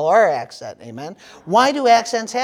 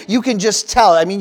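ordinary talk

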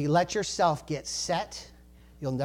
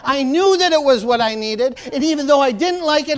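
higher, louder preaching voice; fired-up preaching; 7 semitones higher, 8 dB louder than usual. Real, not acted. Preacher stirring up the congregation, fired-up. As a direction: preach it with rising fire.

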